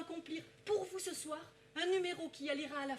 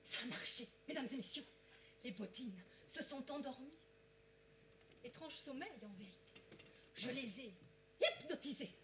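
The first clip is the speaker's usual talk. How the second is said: hushed; 4 semitones lower, 11 dB quieter than usual. Acted. Stage hypnotist hushed to audience.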